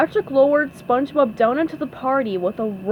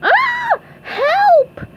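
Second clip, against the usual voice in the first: very high, loud panicked shriek; SpongeBob yelling; 7 dB louder than usual. A narrator's reading. SpongeBob falls from the rope, high panicked yell. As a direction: scream for help in a squeaky voice. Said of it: "yelled SpongeBob".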